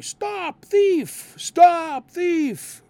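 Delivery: high, loud yelled cry for help; yelling stop thief. Retold or imitated. Robbed victim yells for police, loud high shout. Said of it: "the victim would start yelling".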